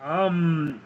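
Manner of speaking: ordinary delivery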